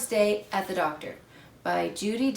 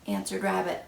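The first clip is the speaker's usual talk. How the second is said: plain reading voice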